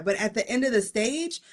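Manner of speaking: usual speaking voice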